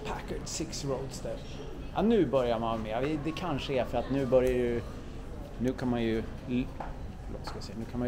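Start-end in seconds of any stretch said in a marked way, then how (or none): none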